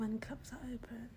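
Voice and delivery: very soft repeat; whispered echo. Pretend. Friend echoing the plan for clubs, soft whisper. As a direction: whisper it back in hushed agreement.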